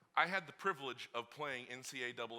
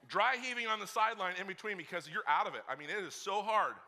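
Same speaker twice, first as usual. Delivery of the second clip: normal talking voice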